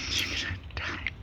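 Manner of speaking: breathy whispered taunt; whispered threat. Real, not acted. Gloating threat to opponent.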